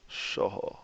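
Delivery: Shoho whispered, no voice. Shown as a demonstration. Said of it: "Short and breathy".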